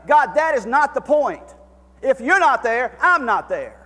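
high, loud preacher's cry to God; impassioned sermon climax; full-force preaching. Acted. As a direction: plead it from the pulpit, full voice. Pleading prayer preached at full force.

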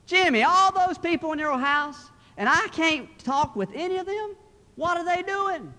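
high, loud phone voice; loud telemarketer. Acted. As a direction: quiz a child loudly down the line. Telemarketer calling a whispering child.

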